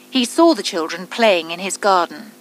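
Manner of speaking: usual speaking voice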